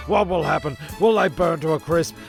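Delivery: ordinary talk, nothing marked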